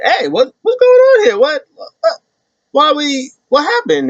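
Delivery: confused Johnny. Retold or imitated. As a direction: squeak out the confusion. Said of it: "Johnny Gargano comes like".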